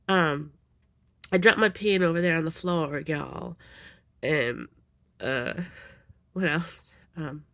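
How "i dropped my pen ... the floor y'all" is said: put-on Texas twang; bad Texas accent. Shown as a demonstration. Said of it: "that's my really bad Texas accent".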